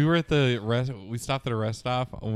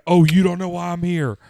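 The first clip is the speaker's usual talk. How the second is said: raised, louder mock taunt; mock menacing quote; 5 semitones higher, 6 dB louder than usual. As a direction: taunt him playfully, a notch higher and louder. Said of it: "imitating the guy".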